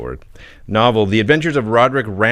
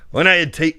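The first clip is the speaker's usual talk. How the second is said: higher-pitched, tentative Scottish reading; attempted Scottish accent; 7 semitones higher than usual. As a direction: try a Scottish accent, then give up. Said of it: "Can you do a Scottish accent?".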